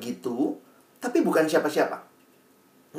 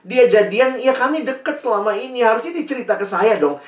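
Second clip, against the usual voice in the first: imitated tearful complaint; 6 semitones higher than usual. Teen complains to mentor about a crush, mock-tearful. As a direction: plead like a wronged teen, lightly hammed-up. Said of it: "Kenapa kamu nangis?".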